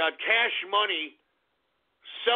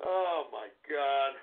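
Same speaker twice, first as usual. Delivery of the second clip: weary groan; 11 semitones lower, 9 dB quieter than usual. Real, not acted. Streamer despairing that his own chat is laughing, low and soft. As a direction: barely voice your disbelief.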